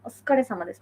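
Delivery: "ওসুকারে" as skare, u dropped; devoiced su. Shown as a demonstration. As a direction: run the s straight into k in "ওসুকারে". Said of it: "সুটা আর কি direct উচ্চারণ হবে না".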